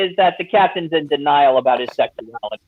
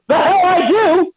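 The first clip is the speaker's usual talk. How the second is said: much higher, louder, slow mock outrage; indignant retort; 13 semitones higher, 4 dB louder, slower than usual. Kareem snaps back at the kid's insult, hammed-up and indignant. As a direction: bite back, offended. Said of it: "And he goes".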